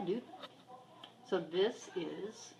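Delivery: ordinary talk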